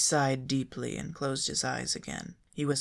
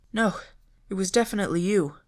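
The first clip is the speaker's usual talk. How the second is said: firm; 6 semitones higher, 8 dB louder, faster than usual. A narrator's reading. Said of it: "Fred said firmly".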